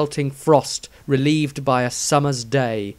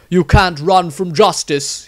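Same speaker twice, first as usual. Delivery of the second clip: slow, louder righteous priest; stern priest; 5 semitones higher, 5 dB louder than usual. Acted. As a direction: pronounce judgement slowly. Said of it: "nowhere to run or hide".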